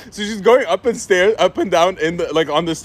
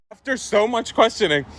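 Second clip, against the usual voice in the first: usual speaking voice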